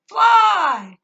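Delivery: very high, full-force shout; imitated yell. Woman repeats it to her a third time, imitated yell. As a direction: belt out the single word, high and loud. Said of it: "she yells as she's going by".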